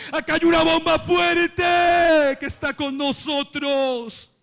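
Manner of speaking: high shouted declaration; ringing preacher's shout; triumphant proclamation. Real, not acted. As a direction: proclaim it to the congregation. Climax of the sermon refrain.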